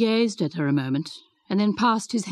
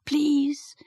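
a higher, fuller please, begging; pleading please; 7 semitones higher than usual. A narrator's reading. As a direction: she tries again, more urgently. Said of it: "began Mary".

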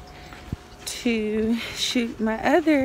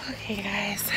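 whisper; 2 semitones lower, 9 dB quieter than usual. Real, not acted. After emotional spoken word piece.

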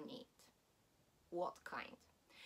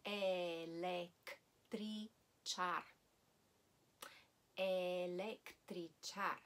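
electrichar, drawn out slowly; slow model word; much slower than usual. Shown as a demonstration. Teacher slowly modelling electrichar for learners. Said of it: "slowing down".

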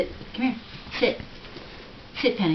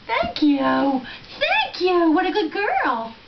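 high, louder gushing thanks; delighted praise; 10 semitones higher, 5 dB louder, much slower than usual. Real, not acted. Owner thanking the dog, high gushing praise. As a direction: gush over the dog.

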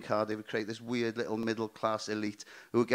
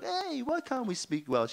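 put-on higher, slow indignant drunk; drunk uppity; 10 semitones higher, 4 dB quieter, slower than usual. Drunk comic challenging the taxi driver, uppity. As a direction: act affronted and tipsy. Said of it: "When I got a bit uppity".

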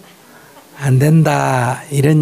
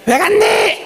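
a high, laughing mock rebuke; scolding husband; much slower than usual. Husband scolds his wife on her return, high and laughing. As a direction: mimic the husband's nagging, squeaky high. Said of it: "하면".

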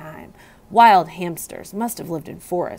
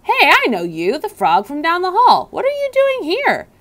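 higher, louder, rising surprise; surprised recognition; 10 semitones higher, 5 dB louder than usual. A narrator's reading. Custodian spotting the frog, surprised.

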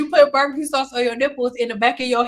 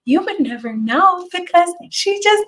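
imitating a mother's careful voice, climbing higher; mother's way of talking; 4 semitones higher, 3 dB louder than usual. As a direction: put on mom's proper voice, rising to a loud exclamation. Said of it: "this is how my mother talks".

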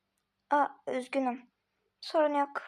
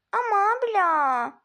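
whiny plea; 5 semitones higher, slower than usual. Acted. Little sibling whining to big sister.

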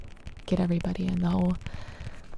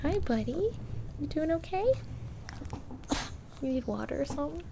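pet talk; 4 semitones higher, 8 dB quieter than usual. Real, not acted. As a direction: coo gently at the pet. Talking to her dog again.

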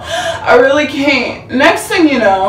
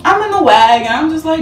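usual speaking voice

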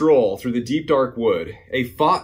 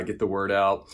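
ordinary delivery, nothing marked

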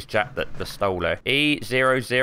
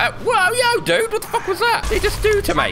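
startled outcry, much higher; shocked high yell. Real, not acted. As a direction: voice leaps up in shock. Shocked by enemy attack.

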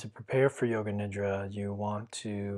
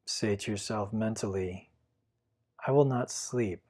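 guided meditation. Acted. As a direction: guide the listener gently into stillness. Leads a Yoga Nidra meditation.

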